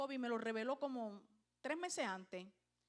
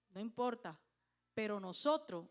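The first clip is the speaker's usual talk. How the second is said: ordinary talk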